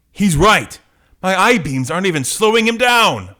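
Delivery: high, loud comic-book hero; alarmed battle cry. Acted. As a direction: call out in alarm over the fight.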